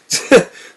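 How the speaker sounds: loud high cackle; maniacal laugh. A narrator's reading. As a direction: burst into wild laughter. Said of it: "another burst of his maniacal laughter".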